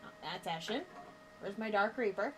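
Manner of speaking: ordinary talk, nothing marked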